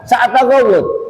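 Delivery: high, clipped mock Saudi phrase; Saudi shorthand. Preacher mimics Saudi shorthand for the congregation, light mock accent. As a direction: fire it off like a local. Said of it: "Ini bahasa singkatan".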